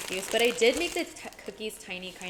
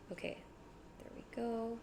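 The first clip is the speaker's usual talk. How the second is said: ordinary talk, nothing marked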